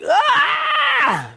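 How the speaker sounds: long high-pitched scream of two naggers; high, drawn-out "aah"; mimicked nagging screech. Mother and wife nagging together, mimicked.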